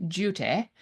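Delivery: Duty said with a British "dyoo-tee", not "doody"; clipped English-accent Duty, avoiding the poo sound. Shown as a demonstration. Host says duty in an English accent to dodge poo. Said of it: "say it with an English accent so that it doesn't sound like poo".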